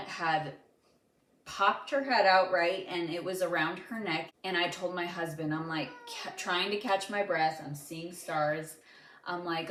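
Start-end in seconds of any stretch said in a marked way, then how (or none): none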